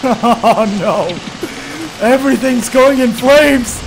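loud, high despairing cry; panicked wail. Real, not acted. Gamer seeing his whole base burn, loud despairing cries. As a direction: wail as everything burns. Everything burning in game.

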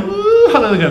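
high, gushing imitation of an enthusiastic mom; excited mom. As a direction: play a mom who is swept up in worship. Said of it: "If mom's out there going".

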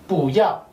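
pu ya with pu rising in second tone; rising bu before ya; tone sandhi rise. Shown as a demonstration. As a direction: let the pu in pu ya rise. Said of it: "la regla del tono me dice que este va a subir".

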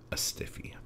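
soft, voiceless whisper; hushed aside while reading aloud; whispered punchline. Real, not acted. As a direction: drop to a whisper for the naughty word. Reading comment, drops to whisper punchline.

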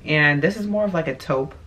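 ordinary talk, nothing marked

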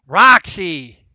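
sharp loud call to the dog. Real, not acted. Gamer shouting at the pet dog to stop, loud. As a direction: shout the name across the room.

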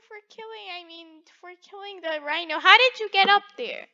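usual speaking voice

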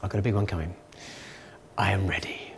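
unvoiced, soft, private whisper; whispered to yourself. Presenter voicing a person on the toilet, whispering. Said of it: "you say to yourself".

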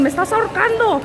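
put-on high, whiny child's protest; child complaining. Son being held tight while crossing, high whiny protest. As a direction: mimic the son's squeezed complaint. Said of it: "Me grita Samuel".

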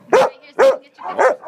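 high, loud, fast coaxing; excited coaxing. Real, not acted. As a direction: coax a kiss eagerly. Coaxing a dog for a kiss.

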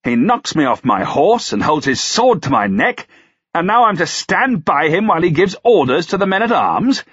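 high-pitched, sarcastic outrage; incredulous lord, pitch riding high; indignant tirade. A narrator's reading. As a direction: rail in disbelief at the suggestion.

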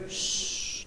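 un shh susurrado, sin voz; siseo de silencio. Retold or imitated. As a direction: pide silencio con un siseo suave. (siseo suave pidiendo silencio)

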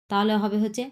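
normal talking voice

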